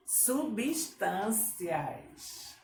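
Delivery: very slow, stretched "Substâncias"; syllable-by-syllable dictation. Shown as a demonstration. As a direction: draw out each syllable of "Substâncias". Teacher dictates word by syllables.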